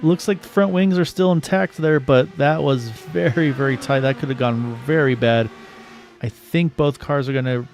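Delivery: fast, lively race call; play-by-play. Acted.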